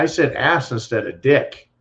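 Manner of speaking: normal talking voice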